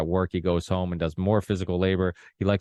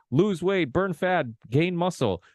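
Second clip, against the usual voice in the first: mocking slogans; 11 semitones higher, slower than usual.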